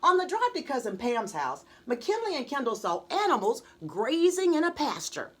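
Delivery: plain reading voice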